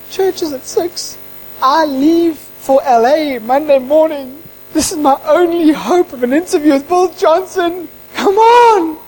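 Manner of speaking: high, drawn-out exasperated pleading; freaking out. Retold or imitated. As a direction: plead in rising panic as time runs out. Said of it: "I'm freaking out".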